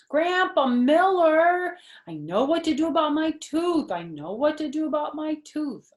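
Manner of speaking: high, loud, happy holler; excited kid yelling to grandpa; hollered real happy. Acted. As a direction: shout it out thrilled to have the answer. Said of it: "I hollered real happy".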